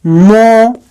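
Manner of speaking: "मो" slowly held on its own; drawn-out "मो"; isolated syllable. Shown as a demonstration. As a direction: stretch out "मो" as one syllable. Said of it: "देखो, तोड़ रहे हो".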